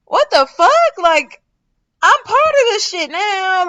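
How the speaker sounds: high, loud what-the-fuck of disbelief; incredulous outburst. Retold or imitated. Telling viewers how scared she was, incredulous. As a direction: blurt it out in shock. Retelling scary ride with boyfriend.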